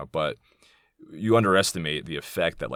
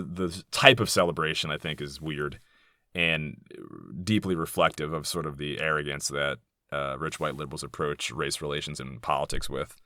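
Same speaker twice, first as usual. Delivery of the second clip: ordinary talk, nothing marked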